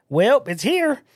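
high-pitched mock bafflement; mock helpless reply. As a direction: act out having no answer for them. Retold baffled reply about the gun.

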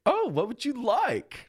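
high and slow, sugary; mock peppy barista. Barista taking his order, high, slow and syrupy. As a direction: stretch it out like a too-cheerful barista. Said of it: "He's like".

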